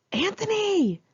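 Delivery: high, drawn-out call into the dark house; screaming a name. Retold or imitated. Friends screaming for their roommate after the quake. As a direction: yell for a friend who might be hurt. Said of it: "we screamed".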